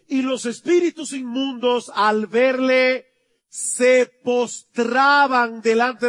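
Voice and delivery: slow, loud, high preaching of the verse; fiery sermon reading; preaching scripture. Acted. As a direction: proclaim the verse slowly and forcefully. Reading scripture at full force.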